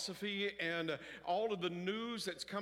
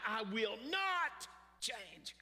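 stubborn refusal; 5 semitones higher, much slower than usual. Retold or imitated. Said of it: "radically decide".

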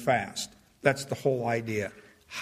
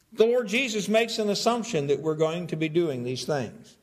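normal talking voice

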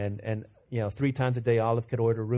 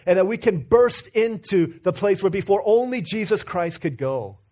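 high, loud, building sermon delivery; fiery preaching; 6 semitones higher, 5 dB louder than usual. Acted. Preacher to congregation, rising fiery sermon.

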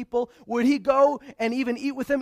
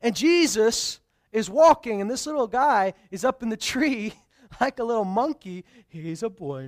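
normal talking voice

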